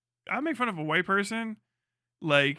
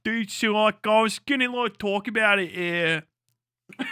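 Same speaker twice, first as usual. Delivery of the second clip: loud, higher Pittsburgh Yinzer voice; full Yinzer accent; 4 semitones higher, 5 dB louder than usual. Acted. Streamer addressing allegations as a Pittsburgh local, hammed-up Yinzer. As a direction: ham up a thick Pittsburgh Yinzer accent, halting. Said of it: "can I get full fucking Yinzer".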